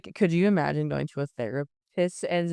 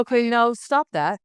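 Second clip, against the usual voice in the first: higher, louder retold therapist cutting in; firm interruption; 6 semitones higher, 4 dB louder than usual. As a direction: imitate a therapist shutting down excuses. Acting out therapist cutting off excuses.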